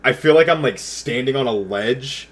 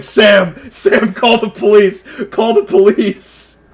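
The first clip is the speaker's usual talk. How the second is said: high, loud, drawn-out panicked yell; retold scream; 8 semitones higher, 5 dB louder, slower than usual. Calling Sam from the living room for police, screaming. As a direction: yell for help as if in danger, re-enacted. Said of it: "and I scream".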